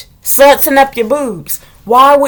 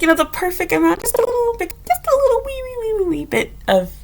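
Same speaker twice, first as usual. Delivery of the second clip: much higher, softer squeak for something tiny; squeaky tiny voice; 12 semitones higher, 6 dB quieter than usual. Acted. Showing how small his lips are, high and squeaky. Describing tiny lip amount.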